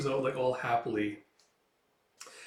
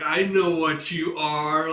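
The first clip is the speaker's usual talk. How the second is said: smirky SpongeBob; 3 semitones higher, 8 dB louder, slower than usual. Retold or imitated. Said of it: "that smirky face".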